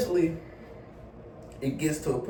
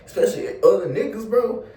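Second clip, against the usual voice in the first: rising higher and louder into "bro"; heated retold complaint; 10 semitones higher, 6 dB louder than usual. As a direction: build the grievance to a peak. Retold angry rant at girl.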